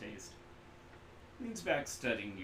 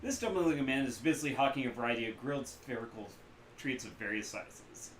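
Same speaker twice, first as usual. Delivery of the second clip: ordinary delivery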